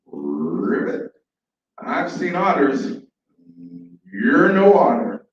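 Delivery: croak, then draw out the dismissal loudly; slow, doubting frog character. Acted. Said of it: "The frog says".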